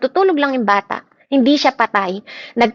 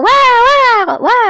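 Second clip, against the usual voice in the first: high loud imitated wail, a quick "wa wa wa"; mock wailing; 12 semitones higher, 7 dB louder, slower than usual. Preacher mimics mourners wailing at a death, hammed-up.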